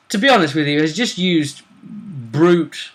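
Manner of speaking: usual speaking voice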